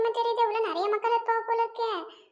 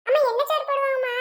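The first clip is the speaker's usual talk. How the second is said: put-on eager child; 4 semitones higher, 3 dB louder than usual. Child asks mother about food.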